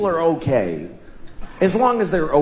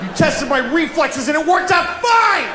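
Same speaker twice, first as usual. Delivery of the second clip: road rage shout; 9 semitones higher than usual. Retold or imitated. Road rage rant continued.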